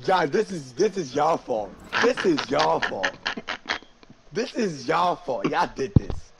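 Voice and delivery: high, loud accusing; loud blaming. Real, not acted. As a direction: blame them, slowing down. Blaming friends loudly.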